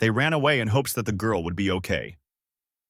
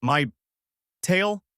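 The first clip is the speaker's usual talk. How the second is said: broken into single words, rising high on the last; halting stutter; 8 semitones higher than usual. Acted. Goku to Chi-Chi after his tail was pulled, halting stutter. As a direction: stammer it out with a gap between words. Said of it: "Goku stuttered out".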